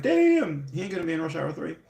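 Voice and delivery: high-pitched Chris Tucker squeal, quick follow-up; Chris Tucker impression. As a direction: yelp it, then rattle off the rest like Chris Tucker. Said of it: "I feel like Chris Tucker in, uh, Rush Hour".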